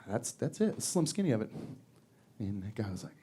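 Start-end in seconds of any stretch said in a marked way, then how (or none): 0.1-1.5 mild flat guard. Retold or imitated. Guard answers flatly, very quiet.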